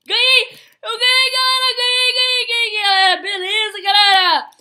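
high, loud singsong cheering; victory cheer. Real, not acted. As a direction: sing out the win. Vence a partida. (comemoração cantada)

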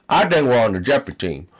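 ordinary talk

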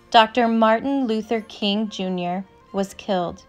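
usual speaking voice

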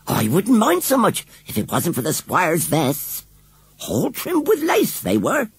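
upset village washerwoman; agitated washerwoman. A narrator's reading. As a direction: blurt it out in short agitated jerks. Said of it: "She spoke in short jerks".

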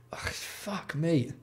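weary defeated groan. Real, not acted. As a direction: sag into it, exhausted. Exasperated at product.

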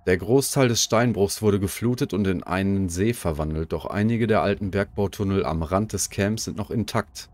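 ordinary talk, nothing marked

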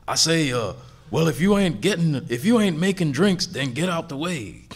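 a hint of a blustering cartoon Southern gentleman; Foghorn Leghorn voice. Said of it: "It's like a cartoon Southern voice".

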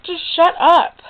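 exasperated. Real, not acted. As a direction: snap it out like you've had enough. Fed up with [name]'s songs.

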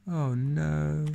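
plain reading voice

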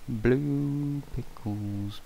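very slow stretched blue pickles; drawn out. Acted. Vlogger savors the phrase, very slow stretch.

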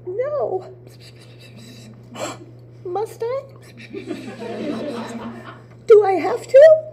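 high, gasping, scared protest; gasp, then plead not to; frightened gasps. Acted. Psyche frightened, gasping at plan.

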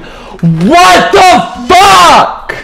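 slow, drawn-out, high furious shout; outraged yell. Real, not acted. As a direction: stretch it out in disbelief and rage.

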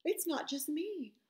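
dawning dread in Isaiah's voice; Isaiah's alarm. Retold or imitated. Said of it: "And then he thought".

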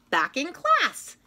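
plain reading voice

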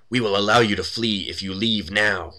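loud mock-menacing command; acted screamed warning. A magic mouth gives intruders a last warning, acted loud threat. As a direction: raise the voice in a hammed-up threat. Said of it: "A mouth on the wall opposite screams".

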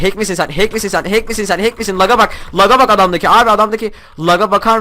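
high, loud disbelieving shouts; calling out the opponent in shock; shocked outcry. Real, not acted.

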